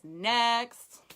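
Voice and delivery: a sharp, loud one-word brush-off; loud dismissive next. Retold or imitated. Telling her team how she waves off refusals, loud and breezy. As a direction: shrug off the no with a loud call. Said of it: "what I always say is".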